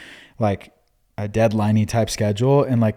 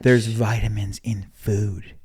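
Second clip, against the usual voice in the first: whisper; 6 dB quieter, slower than usual. Real, not acted. Teasing aside to wife.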